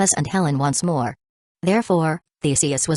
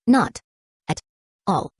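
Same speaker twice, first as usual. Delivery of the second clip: through gritted teeth; 3 semitones higher than usual. A narrator's reading. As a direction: spit it out through a tight smile. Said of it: "smiling through gritted teeth".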